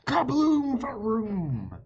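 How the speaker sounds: loud, high booming shout; big, loud kaboom; explosion sound. Acted. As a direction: burst out like an explosion. Explosion in the story.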